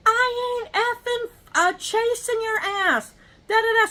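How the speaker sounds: high, slow mocking neighbor rant; mock the neighbor shouting at his dogs; neighbor yelling. Neighbor yelling at his loose dogs, high slow mockery. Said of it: "He starts yelling at the dogs".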